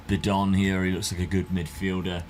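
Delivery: ordinary talk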